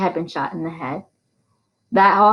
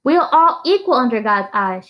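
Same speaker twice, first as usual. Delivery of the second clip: raised, ringing declaration; firm declaration; 5 semitones higher, 4 dB louder than usual. A narrator's reading. Teacher declaring equality to the sheriff, firm and raised. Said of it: "she declared".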